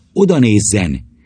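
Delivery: ordinary delivery, nothing marked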